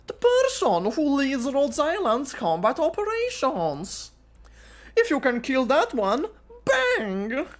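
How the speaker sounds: high, sly schemer's voice; high, teasing villain coaxing an ally; scheming W. Acted. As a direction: coax him like a sly mercenary.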